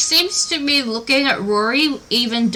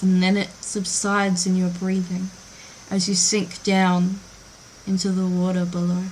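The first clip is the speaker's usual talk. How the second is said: soft, even, immersive storytelling; hushed, measured scene-setting with lingering pauses; game master narration; 4 semitones lower, 7 dB quieter than usual. Acted.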